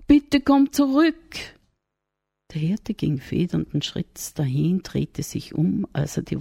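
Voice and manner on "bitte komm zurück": high, loud call after someone leaving. Acted. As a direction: call him back across the field. Maria calls after the shepherd.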